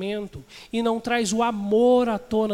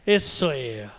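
peito estufado; 8 semitones lower, much slower than usual. Retold or imitated. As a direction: puff up your chest, smug and low. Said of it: "vai encher o peito e vai falar assim". (imitando o homem orgulhoso, voz grave)

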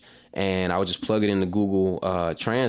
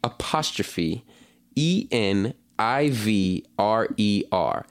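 slow spelling; much slower than usual. Shown as a demonstration. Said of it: "I'ma have to spell that".

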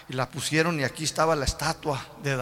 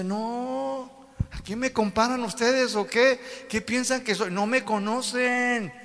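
high, slow, proud divine voice; God challenging the people, high and slow; 6 semitones higher, slower than usual. Acted. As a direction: declare His greatness, slow and lofty. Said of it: "El Señor dice".

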